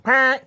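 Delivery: high, loud sharp "Pah"; mock horn blast. Dad imitating the horn to scare his son. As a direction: bark out a car horn noise. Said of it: "I went".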